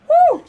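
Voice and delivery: very loud, high "woo!"; celebratory high whoop; excited whoop. Real, not acted. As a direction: let out a piercing whoop. Whoop at ankle-breaking move.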